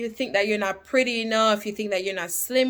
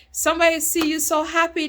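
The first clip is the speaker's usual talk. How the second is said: ordinary delivery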